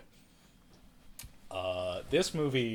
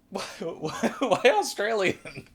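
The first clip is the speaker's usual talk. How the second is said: amused disbelief; 11 semitones higher, 8 dB louder than usual. Real, not acted.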